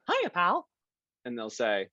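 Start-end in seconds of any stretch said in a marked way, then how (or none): 0.1-0.7 high squeaky cartoon mouse, bright and friendly; Mickey Mouse voice. Shown as a demonstration. Voice actor greeting fans as Mickey, high cartoon mouse voice. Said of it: "I'll do the voice for them".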